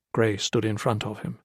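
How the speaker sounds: ordinary delivery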